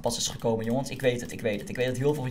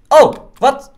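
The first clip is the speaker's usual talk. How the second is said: high, loud astonished yelp; suddenly baffled, jump up; startled surprise; 9 semitones higher, 13 dB louder than usual. Real, not acted. Sees the chicken suit has no trousers, loud startled what.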